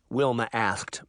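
ordinary delivery

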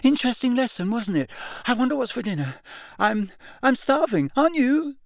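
strained, unnaturally high and forced. A narrator's reading. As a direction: squeak it out, pretending all is fine. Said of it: "his voice much higher than usual".

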